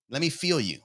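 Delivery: ordinary talk, nothing marked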